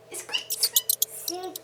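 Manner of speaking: high whispered squeak squeak; mouse squeaks. Shown as a demonstration. Showing the child a mouse's sound, tiny whispered squeaks. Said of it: "What's the sound of mouse?".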